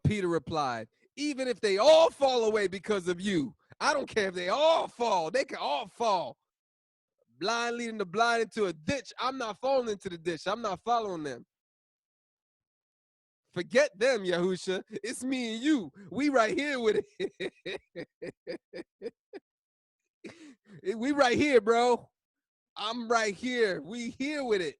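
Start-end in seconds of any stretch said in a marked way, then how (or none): 1.2-17.5 higher, brash loyal boast; bold Peter. Acted. Voices bold Peter to Yahusha. 20.9-24.8 higher, brash loyal boast; bold Peter. Acted.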